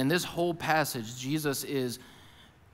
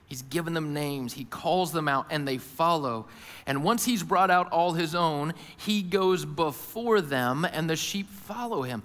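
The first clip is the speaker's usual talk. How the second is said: ordinary talk, nothing marked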